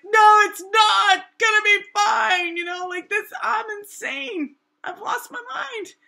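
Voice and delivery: drawn-out high wail of despair, hammed up; mock hysterical shout. Replying to her upbeat friend, retold frantic yelling. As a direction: shout it high, like someone losing it.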